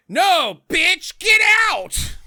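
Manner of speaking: high, loud, slow mock yell at her; mock shout. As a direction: bark it out, slow and shrill-high. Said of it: "And she's like".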